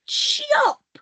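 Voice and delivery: a sharp, high, ringing "Chop!" sound effect; chopping sound. A narrator's reading. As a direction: ring out the axe blow high and sharp. Hero chops tree, sound effect.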